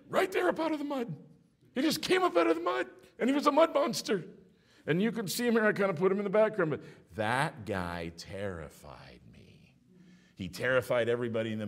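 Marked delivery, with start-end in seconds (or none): 0.1-6.7 high, loud, spooked telling; hammed-up scared excitement; mock horror. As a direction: play up the fright of the mud monster. Showing the scary monster slide.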